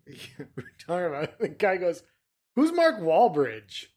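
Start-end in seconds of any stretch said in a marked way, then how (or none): 2.5-3.9 loud, slow quote saying "Wahlberg" as "wall-bridge"; mispronounced name. Retold or imitated. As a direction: imitate the clueless coworker, turning "Wahlberg" into "wall-bridge". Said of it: "he called him Mark Wahlberg".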